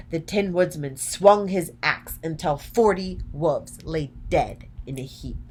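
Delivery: ordinary delivery, nothing marked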